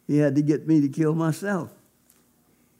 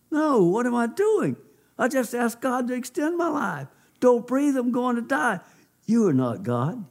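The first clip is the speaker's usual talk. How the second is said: high-pitched protest, short of a real scream; retold inner scream; 7 semitones higher than usual. Man refuses the deceiving voice as he retells it, mock scream in high pitch. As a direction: cry out in alarm, pitched high, then drop to a firm rebuke. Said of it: "screamed as loud as I could".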